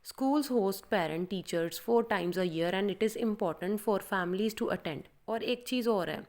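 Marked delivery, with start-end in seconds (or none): 0.1-5.1 slow, even read-aloud pace; slow clear reading. Shown as a demonstration. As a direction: read it out slowly, word by word. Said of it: "तो आप ऐसे बोल सकते हो". (धीमी, साफ़ पढ़ाई)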